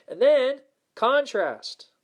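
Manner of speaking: slow, high and loud on "contrast"; "And then contrast" stretched out and punched; dramatic reveal. Shown as a demonstration. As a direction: land the twist big and slow. Dramatic turn in the steps.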